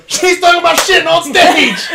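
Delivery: loud, high laughing shout. Retold or imitated. Shouting back at the angry drunk woman, loud and laughing. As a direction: yell it across the room. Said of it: "And I go".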